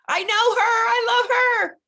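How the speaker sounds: high, loud, stretched-out gush; gushing love. Real, not acted. Coach gushing to viewers about her client Bonnie, loud and high. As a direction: belt out your love for her.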